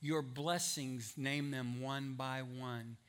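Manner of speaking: slow, soft, low recitation; old hymn recital. Retold or imitated. Preacher recalls an old congregational song, slow hushed recital. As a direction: count it off slowly and quietly, phrase by phrase. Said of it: "It's an old song we used to sing".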